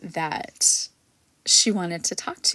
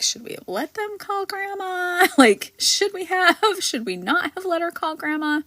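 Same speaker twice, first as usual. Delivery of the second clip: worried parent; 12 semitones higher than usual. Retold or imitated. Daughter and son-in-law worried in background.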